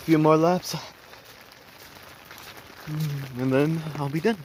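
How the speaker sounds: cold-shaken voice; shivering. Real, not acted. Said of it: "Sorry, I'm shivering once again".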